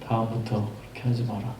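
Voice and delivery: soft, low-key stern warning from a father; hushed one-line rebuke; quiet stern father. Retold or imitated. Father finally speaking to the son late at night, hushed and stern. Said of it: "한마디를 던지셨습니다".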